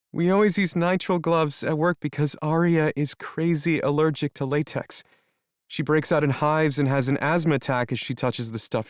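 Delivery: dawning fear in her voice; frightened young woman. Acted. Melody realizing, frightened.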